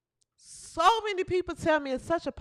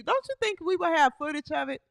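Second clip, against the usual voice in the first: ordinary talk, nothing marked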